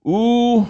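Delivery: Ooh with lips pursed, high and long; rounded ooh. Shown as a demonstration. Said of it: "You have to purse your lips".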